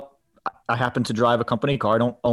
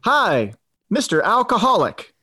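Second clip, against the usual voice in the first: bright, upbeat telemarketer greeting, a bit higher; cheery sales caller greeting; 7 semitones higher, slower than usual. Retold or imitated. Telling a prank call story, a lightly higher cheery caller greeting. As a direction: greet the mark like an eager salesman. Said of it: "the guy comes back on and he goes".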